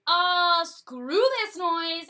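walk out in disgust; fed-up lords. Retold or imitated. Lords quitting King John, loud and fed up. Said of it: "they basically say".

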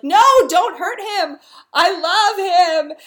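loud, high melodramatic cry; mock desperate plea. Olivia coming between the fighters, mock desperate cry. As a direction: wail it out as a hammed-up lover's plea.

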